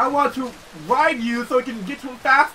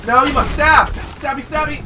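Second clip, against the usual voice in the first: high, louder insistent command; urging pet; 5 semitones higher, 4 dB louder than usual. Pretend. Arguing with his pet, high insistent. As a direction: order the pet to stab.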